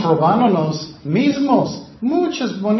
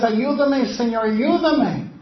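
higher, slow pleading; begging; 6 semitones higher than usual. Acted. Mother begging Jesus for help, higher pleading. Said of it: "Ella está rogando".

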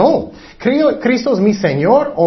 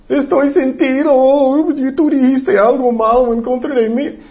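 self-pitying mock whine; 7 semitones higher than usual. Preacher imitates someone offended and blaming others, mock whine. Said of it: "Él sentía mal por él mismo".

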